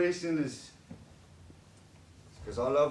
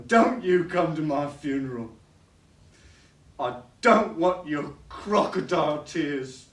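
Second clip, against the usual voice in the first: louder, bitter, lashing out at her; angry outburst; 6 dB louder than usual. Acted. Drunk father raging at his daughter over his funeral. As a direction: spit it at her like a wounded father.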